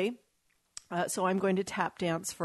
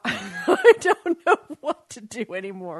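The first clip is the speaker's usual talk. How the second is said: loud laugh of despair; exasperated laughing; frustrated laugh; 3 semitones higher, 8 dB louder than usual. Real, not acted. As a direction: laugh it out, helpless and fed up. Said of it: "I'm so frustrated right now".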